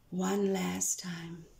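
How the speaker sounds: slow soft whisper. Acted. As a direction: hush them before the last verse. Poem, whispered before final verse.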